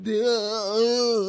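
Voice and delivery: drawn-out loud mock groan; exaggerated slack-jawed shock; dumbfounded audience. Host imitates the stars' faces, mock dumbfounded. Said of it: "You see their faces like".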